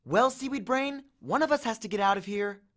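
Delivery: high, sharp snap; snapping. A narrator's reading. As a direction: bite the words off at him. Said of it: "and snapped".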